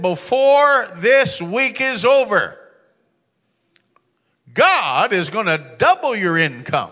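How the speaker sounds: very slow, loud, high, stretched out; thundering prophecy. Retold or imitated. Preacher prophesying over Earl, slow thundering declaration. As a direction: call it out like a revival preacher.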